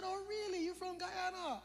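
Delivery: high, lightly teasing, with a gentle smile; sweetly skeptical, a smile in the voice; gentle smiling question. Retold or imitated. Preacher to student he doubts, high gentle smiling tone. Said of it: "gentle smile on my face".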